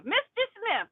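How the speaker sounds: a hint of a smug judge; sarcastic judge. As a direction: sing the name out high like a condescending judge. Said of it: "Sarcastic people".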